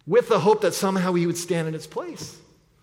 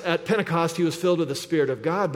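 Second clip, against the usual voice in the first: ordinary delivery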